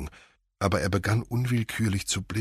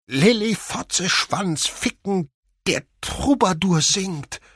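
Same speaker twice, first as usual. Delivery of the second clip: much higher and louder, slow and drawn out; louder tic outburst; 14 semitones higher, 8 dB louder, much slower than usual. Acted. Will yelling tic words when asked about Lilly, loud and high. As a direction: blast the words out high and stretched. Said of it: "Die Wörter kamen jetzt lauter heraus".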